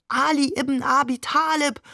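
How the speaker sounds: frenzied crowd cry. A narrator's reading. Pilgrims around the shrine, frenzied calling out. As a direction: call it out like a fervent pilgrim. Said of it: "wie verrückt".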